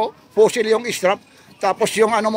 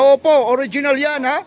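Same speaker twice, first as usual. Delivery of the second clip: louder, raised-pitch mock shout; imitated shout; 6 semitones higher, 4 dB louder than usual. Vlogger quoting the watch seller's guarantee, imitated shout. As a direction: quote the seller's insistent call, voice lifted. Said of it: "Sumisigaw pa talaga si Kuya Dodong".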